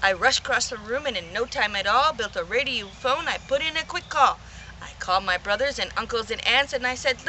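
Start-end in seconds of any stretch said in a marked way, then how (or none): none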